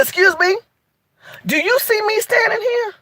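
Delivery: high, indignant confrontation; indignant Shaniqua. Retold or imitated. To a rude diner, an indignant, high-pitched rebuke. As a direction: put the rude man in his place. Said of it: "I got Shaniqua on him".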